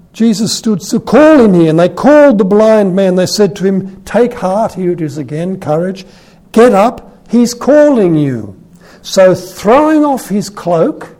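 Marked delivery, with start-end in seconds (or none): 0.1-4.7 loud, high, forceful proclamation; scripture reading. A narrator's reading. Preacher reads of the crowd calling the blind man, loud proclamation. 6.5-11.2 loud, high, rousing proclamation; scripture reading. A narrator's reading. Preacher reads of the beggar being called, loud rousing reading.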